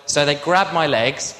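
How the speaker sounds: ordinary delivery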